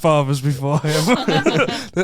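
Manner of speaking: slow, grand family-legacy declaration, laughing through it; hammed-up solemn vow over a sponge; mock solemn heirloom speech.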